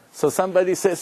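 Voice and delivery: normal talking voice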